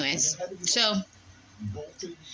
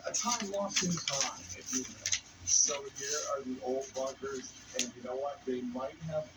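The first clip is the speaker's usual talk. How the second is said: hushed; 4 semitones lower, 10 dB quieter than usual. Real, not acted. Hushed while exploring old bunkers.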